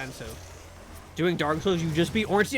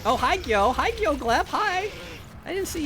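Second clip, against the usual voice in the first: squeaky greeting; 10 semitones higher than usual. Real, not acted. Greeting a chatter.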